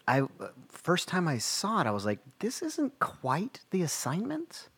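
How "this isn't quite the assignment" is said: puzzled. Retold or imitated. Teacher retelling her own thoughts.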